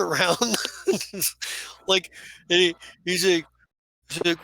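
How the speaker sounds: ordinary delivery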